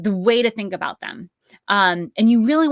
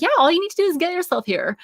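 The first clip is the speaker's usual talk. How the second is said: higher, breezy invitation; breezy festival organizer; 11 semitones higher, 4 dB louder than usual. Retold or imitated. Festival organizer casually inviting the band, breezy. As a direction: say it like it's no big deal. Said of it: "they're like".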